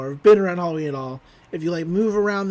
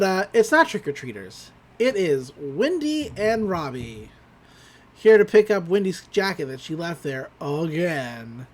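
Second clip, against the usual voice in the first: normal talking voice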